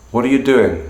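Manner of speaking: doing falls at the end; falling tone. Shown as a demonstration. Teacher comparing three ways to ask. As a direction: let doing drop. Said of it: "are all fundamentally the same question".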